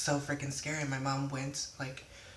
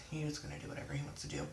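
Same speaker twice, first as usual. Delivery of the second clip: whispered aside; 6 dB quieter, faster than usual. Real, not acted. Aside about brother's teasing.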